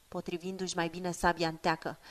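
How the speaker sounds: ordinary delivery, nothing marked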